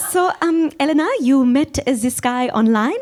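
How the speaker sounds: put-on high, careful German-accented questioning; German friend. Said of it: "I told my German friend".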